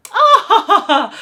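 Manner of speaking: high, loud theatrical head-back laugh; big fake laugh. Accompanist realising her mistake, loud theatrical laugh. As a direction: throw your head back and cackle. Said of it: "laughs, throws her head back".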